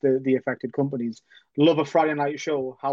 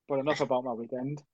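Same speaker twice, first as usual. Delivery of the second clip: ordinary talk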